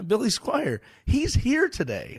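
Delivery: a chirpy cashier breaking big news; excited cashier. Retold or imitated. Cashier excitedly announces star.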